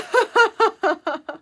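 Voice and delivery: sudden loud laugh; loud high laugh. Real, not acted. Laughing at chat.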